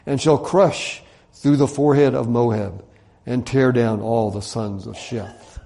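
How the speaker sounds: slow, emphatic prophecy; scripture reading. Acted. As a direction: proclaim each phrase with weight. Reads scripture aloud.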